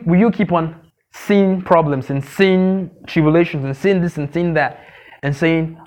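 ordinary talk

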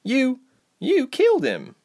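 shocked. Acted. Reshma shocked after killing.

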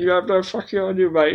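high, quick, incredulous echo; mock outrage. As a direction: throw it back in pretend disbelief. Mock outrage repeating co-host's words.

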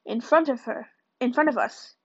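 plain reading voice

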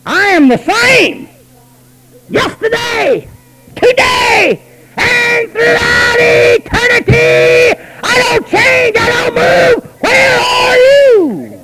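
high, loud, slow booming shout; God's thundering voice. Acted. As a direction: thunder it out as God himself. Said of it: "And God says".

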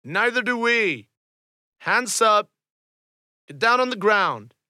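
loud, high stern orders; commanding shout. Acted. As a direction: order them to the ground. NPC commands at gunpoint.

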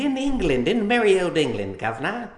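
high, plummy put-on English voice, hammed up; cheeky fake British toff calling someone governor; mock British accent. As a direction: play a jolly old Englishman for a laugh. Jokey echo of England mention.

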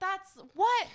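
high-pitched scoff of disbelief. Retold or imitated. Imitating hosts reacting to an outrageous remark, scoffing. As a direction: pitch it up, incredulous and dismissive. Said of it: "they would all scoff and be like".

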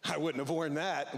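slow, lightly snide aside; a touch of church gossip; catty backhanded remark. Retold or imitated. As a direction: mutter the put-down as you walk away. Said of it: "the backhanded comment".

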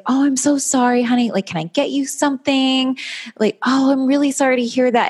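high-pitched imitation of a doting wife; sweet imitation. Friend mimicking wife comforting husband after rough day, sweetly. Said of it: "she's just, like, the sweetest".

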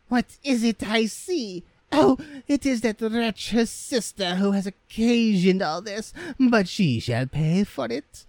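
very high, shrieking-pitched mother; high, furious mother voice; outraged mother. Acted. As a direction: rage at the absent daughter in a high voice. Mother raging at sister.

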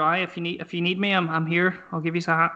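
usual speaking voice

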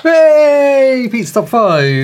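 excited announcer call. Pretend. Jingle intro for the segment.

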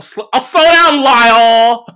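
high, loud, sarcastic slow-down promise; mock girlfriend. Imitating girlfriend huffily promising Lyle she'll slow down, high and loud. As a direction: whine it sarcastically.

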